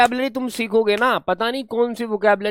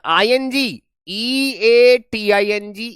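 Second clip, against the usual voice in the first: E A T I N G spelt out slowly; dictating the spelling slowly; 3 semitones higher, much slower than usual. Shown as a demonstration. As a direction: stretch each letter of the spelling. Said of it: "spelling verb form".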